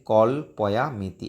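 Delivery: কল্পয়ামিতি said slowly, each syllable clear; careful recitation. Shown as a demonstration. Teacher modelling the word for students, slow and careful. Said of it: "এইভাবে উচ্চারণ হবে".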